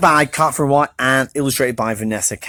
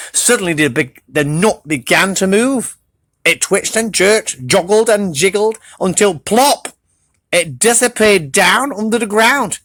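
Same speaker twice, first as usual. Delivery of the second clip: ordinary delivery, nothing marked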